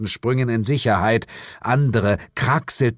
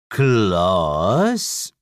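long drawn-out, dipping then rising question; puzzled devil. Acted. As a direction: stretch the name in slow disbelief. Devil peers at the visitor.

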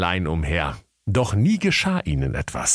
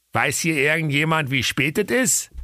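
put-on Berlin accent; 9 semitones higher than usual. A narrator's reading. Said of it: "Der Berliner Bär fragte".

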